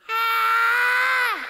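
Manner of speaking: long high scream; loud sustained yell of panic; falling scream. Acted. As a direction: scream as the ladder drops from under you. Ladder gives way, he falls.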